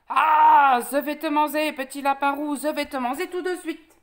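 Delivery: loud roar, then lisped threat; lisping wolf. Acted. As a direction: pounce with a cry, saying "ze" for "je". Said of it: "un gros cheveu sur la langue". (loup qui bondit en zozotant)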